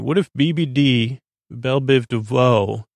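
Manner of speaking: usual speaking voice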